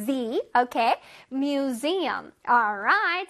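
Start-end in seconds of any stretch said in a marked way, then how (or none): 1.3-2.3 Museum with a sharp buzzing z in the middle; clear z sound. Shown as a demonstration. Teacher stressing the z for kids. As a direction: lean on the z in Museum. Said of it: "Z 발음을 내준다고".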